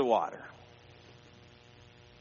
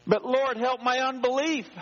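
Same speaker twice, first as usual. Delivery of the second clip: high, ringing plea to the Lord; preacher's sudden plea, pitched high; fervent prayer cry; 10 semitones higher, 4 dB louder than usual. Acted. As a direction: cry it up to heaven, pleading.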